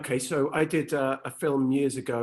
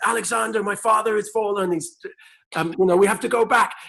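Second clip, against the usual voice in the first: higher, urgent battle call; lightly acted urgent soldier; 7 semitones higher, 3 dB louder than usual. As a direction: call it out like a messenger. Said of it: "And I'd say".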